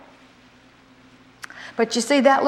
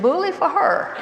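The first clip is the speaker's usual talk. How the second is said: sarcastic; 6 semitones higher than usual. Retold or imitated. Wife's sarcastic reply to husband.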